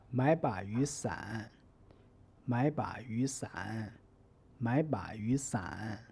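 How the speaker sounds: slow, deliberate 买把雨伞 for learners to copy; repeat-after-me tone model. Shown as a demonstration. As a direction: say 买把雨伞 slowly, each syllable's tone drawn out. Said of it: "Listen carefully and repeat after me".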